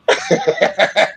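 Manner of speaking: a quick laugh after the jab; short laugh. Real, not acted. Laughing at his own sarcastic jab at the young man, short laugh. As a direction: laugh at your own joke.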